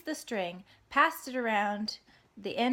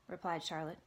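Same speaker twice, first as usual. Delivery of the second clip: ordinary delivery, nothing marked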